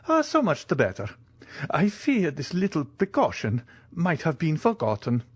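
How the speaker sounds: put-on high-pitched old soldier, eager and a little worried; anxious Italian major. Italian visitor fears his arrival went unannounced, high anxious voice.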